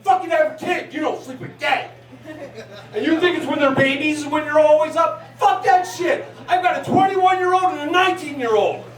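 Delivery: ordinary talk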